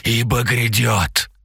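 wheezing fanatic's cry; raspy cry. A narrator's reading. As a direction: croak it out like a dying cultist. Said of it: "Хрипящий возглас".

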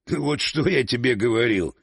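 lightly voiced laughing remark; through laughter. A narrator's reading. Oleg to his brother after winning the bet, mildly laughing. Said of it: "сквозь смех сказал Олег".

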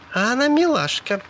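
high, cooing woman's voice; playful admiration. A narrator's reading. Sally admires the hitchhiker, then aims.

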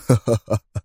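short sneering laugh; mocking confident laugh. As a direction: Yaron laughs off the insult, self-assured. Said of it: "أطلق ضحكة ساخرة واثقة".

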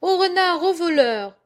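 loud, high shout for help; alarm cry. A narrator's reading. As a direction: raise the hue and cry. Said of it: "s'écria-t-elle". (cri fort et aigu pour donner l'alerte)

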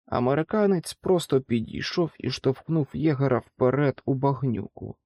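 ordinary delivery

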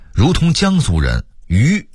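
ordinary talk, nothing marked

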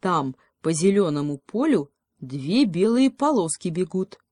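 ordinary delivery

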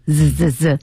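imitated buzzing "zzz" in three bursts; electric buzz imitation. Shown as a demonstration. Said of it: "这句全是电流的声音". (模仿电流滋滋声)

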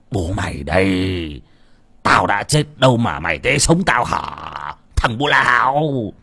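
put-on slow, drawn-out angry rasp of an old man; indignant old father, words dragged out; seething hiss. A narrator's reading. Father thought dead snaps at his wailing son, seething. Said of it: "bật dậy mà rít lên".

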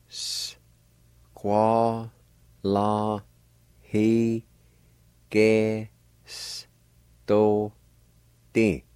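very slow, each syllable separated by a pause. Shown as a demonstration. Teacher breaking a Cherokee word down for learners, syllable by syllable. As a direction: sound out each syllable on its own, drawn out. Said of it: "Pronounced like this".

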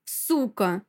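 mild, unraised angry cry; understated furious insult; shouted insult. A narrator's reading. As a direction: curse after her. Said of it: "кричу ей вслед".

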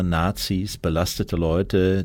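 ordinary delivery

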